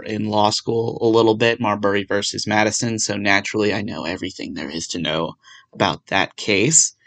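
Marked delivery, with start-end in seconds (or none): 3.0-6.9 sarcastic. Acted. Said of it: "that was absolute sarcasm".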